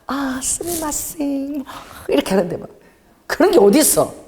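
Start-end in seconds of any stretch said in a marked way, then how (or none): 0.1-1.6 soft, slow, hushed Japanese sorry; bow your voice, tiptoe the words; timid apology. Retold or imitated. Japanese passerby apologizing cautiously, softly and slowly. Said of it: "조심해갖고".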